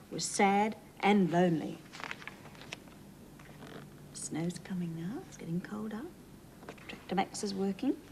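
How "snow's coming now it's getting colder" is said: soft whispered aside to little listeners. Real, not acted. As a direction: lean in and whisper it. Host whispers aside to children.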